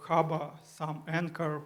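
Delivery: ordinary delivery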